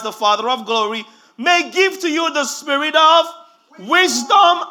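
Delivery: reading Paul's prayer aloud, loud and high, building up; preaching at full force. Acted. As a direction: proclaim the verses with mounting force.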